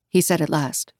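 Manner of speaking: plain reading voice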